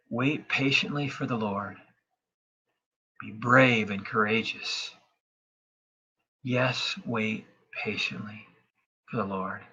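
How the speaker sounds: slow, softening toward the end; slow gentle verse. Shown as a demonstration. Pastor has listeners repeat verse, slow and gentle. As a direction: lead them calmly and patiently. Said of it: "just say these words after me".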